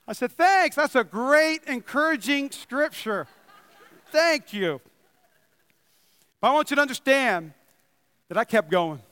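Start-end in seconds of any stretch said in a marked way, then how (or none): 0.3-4.8 sarcastic thanks. Pretend. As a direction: thank him with a wry, sarcastic bite. Sarcastic reply to stinging verse.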